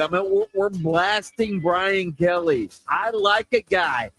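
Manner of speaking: ordinary delivery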